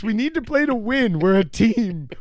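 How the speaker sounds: high, loud mock rage at a ranked teammate; exasperated gamer. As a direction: plead with the team in frustration. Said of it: "and been like".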